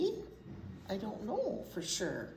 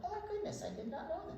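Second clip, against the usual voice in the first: surprised; 11 semitones higher, 8 dB quieter, much faster than usual. Real, not acted. Reacting to tallest tree fact.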